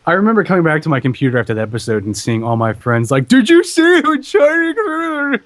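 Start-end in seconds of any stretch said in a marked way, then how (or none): 3.3-5.4 high, slow, excited fan voice. Retold or imitated. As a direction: mimic the shocked fans. Friends quoted.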